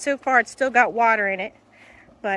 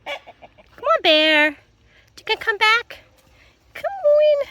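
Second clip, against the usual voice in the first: very high, drawn-out coaxing call; squeaky come-here call; high dog calling; 11 semitones higher, slower than usual. Real, not acted. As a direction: lure the dog back with a high sweet call.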